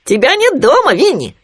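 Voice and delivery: very high, loud little animal voice; Khryunya. A narrator's reading. Khryunya visiting his friend Winnie, high piping voice. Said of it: "сказал Хрюня".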